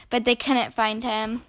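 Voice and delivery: usual speaking voice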